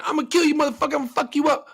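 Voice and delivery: hammed-up threat from a mouthy player; mock loudmouth gamer. Mimicking a loud mouth in a shooter lobby, hammed-up trash talk. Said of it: "If you're a really loud mouth".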